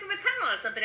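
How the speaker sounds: normal talking voice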